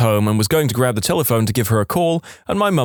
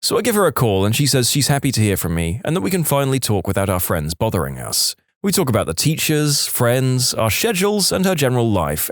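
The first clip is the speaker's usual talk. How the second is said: ordinary delivery, nothing marked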